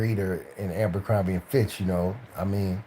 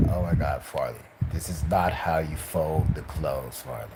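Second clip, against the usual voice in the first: a hint of a fussy supervisor, lower and softer; lightly voiced fussy boss scolding; supervisor impression; 5 semitones lower, 4 dB quieter than usual. Mocking his old supervisor, mild fussy scold. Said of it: "he talked like this".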